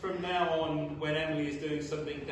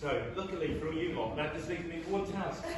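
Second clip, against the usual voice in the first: normal talking voice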